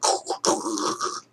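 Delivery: soft voiceless crackle; imitated monitor static. Imitating the wand being moved around, soft static noise. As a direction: rasp like a probe sliding over skin. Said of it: "You can hear it going".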